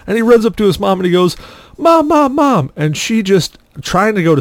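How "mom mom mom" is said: put-on high-pitched kid calling for Mom; mock-panicked calling, pitched way up; urgent calling. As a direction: imitate an urgent cry for Mom. Boy runs to mom, hurt.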